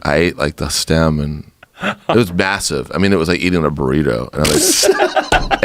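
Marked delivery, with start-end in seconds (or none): 4.3-5.6 high, slow laugh through the words; giggling at the memory. Real, not acted. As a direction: crack up mid-sentence. Laughing while telling drug story.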